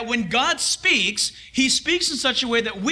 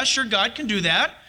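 normal talking voice

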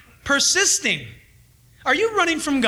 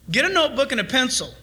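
ordinary delivery

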